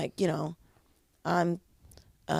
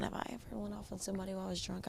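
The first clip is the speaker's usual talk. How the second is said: quiet musing; 3 semitones higher, 16 dB quieter, faster than usual. Real, not acted. Musing to herself.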